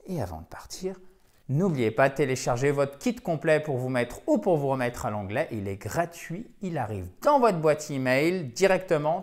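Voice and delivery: ordinary delivery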